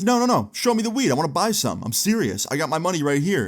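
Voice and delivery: high, insistent imitation of an annoyed man; angry sarcasm. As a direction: start high and pushy, mimicking his irritation. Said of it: "He sounds angry".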